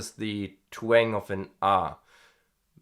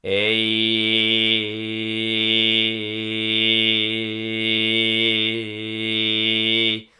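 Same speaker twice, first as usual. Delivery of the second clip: loud long twanged A; 7 dB louder, much slower than usual. Shown as a demonstration. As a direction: sing the A with twang, hold it steady. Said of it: "let's do the A".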